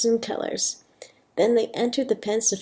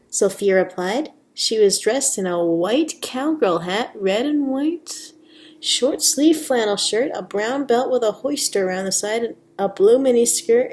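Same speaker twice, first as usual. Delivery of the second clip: ordinary delivery, nothing marked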